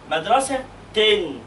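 MADRASA-TIN, slow, split before a long "tin"; kasr plus noon. Shown as a demonstration. Teacher breaks down the word for pupil, slow MADRASA-TIN. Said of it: "كسرة ونون".

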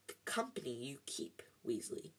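a cold, voiceless whisper; sneering whisper. A narrator's reading. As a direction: hiss the insult under your breath. Lucius Malfoy sneers at Weasley.

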